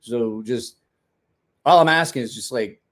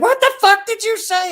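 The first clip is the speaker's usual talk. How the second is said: much higher, louder outraged screech; furious snap; 10 dB louder than usual. Pretend. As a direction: explode at him over nothing. Imagined daughter snapping at husband.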